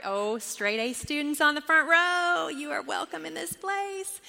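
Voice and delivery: mock-impressed, high-pitched welcome; playful praise. Real, not acted. Playfully praising sharp front row.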